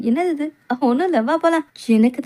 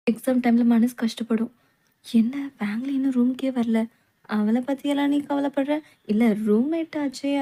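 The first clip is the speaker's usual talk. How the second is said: ordinary talk, nothing marked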